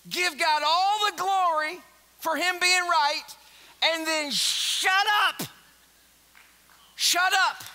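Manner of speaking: forceful preaching yell; loud commands. Acted. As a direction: bark the orders at them.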